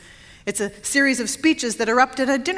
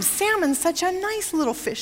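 high-pitched, softer coaxing; higher, gently mocking sales pitch; coaxing server; 5 semitones higher, 5 dB quieter than usual. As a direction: mimic a sweetly persuasive server. Server coaxing vegetarian to eat salmon.

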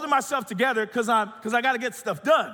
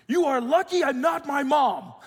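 high, raised scolding voice; imitated mad mom; 7 semitones higher, 4 dB louder than usual. Wife mad at the kids, imitated high scolding. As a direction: scold it higher, putting on an angry mother. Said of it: "when she gets mad at the kids".

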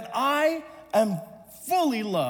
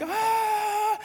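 high-pitched open "ah" of panic; high mock scream; 11 semitones higher than usual. Drowning boy crying out before going under, mock scream. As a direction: yelp like a drowning kid. Acting out drowning boy's cry.

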